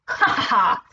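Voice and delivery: acted "ha ha ha"; gloating laugh. A narrator's reading. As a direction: laugh at fooling them.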